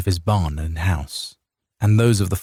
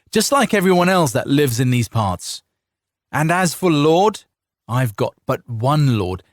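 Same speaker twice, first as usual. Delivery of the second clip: angry defiance; 7 semitones higher than usual. A narrator's reading. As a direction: defend your land, furious. Farmer defends his land.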